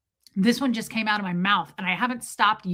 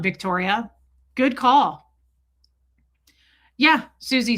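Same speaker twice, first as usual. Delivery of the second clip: ordinary talk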